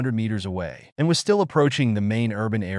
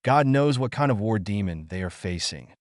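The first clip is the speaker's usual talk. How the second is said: ordinary delivery, nothing marked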